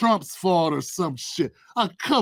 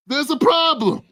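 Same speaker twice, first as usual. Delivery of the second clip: ordinary talk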